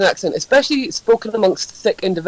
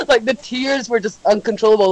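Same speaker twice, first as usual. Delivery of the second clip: ordinary talk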